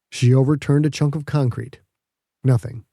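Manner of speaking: ordinary delivery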